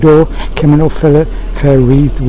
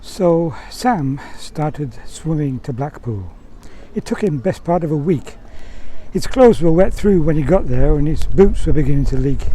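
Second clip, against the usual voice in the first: quiet narration; 9 dB quieter than usual. A narrator's reading.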